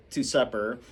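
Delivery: ordinary delivery, nothing marked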